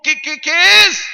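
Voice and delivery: loud, high shout on the final "es"; hammed-up yell of pressing curiosity; imitated eager demand. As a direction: burst out impatiently, pitch jumping up.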